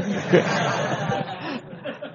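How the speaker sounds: high-pitched burst of laughter; giggling at his own punchline. Real, not acted. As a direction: crack up mid-story, laughing high. Storyteller laughs at own tale.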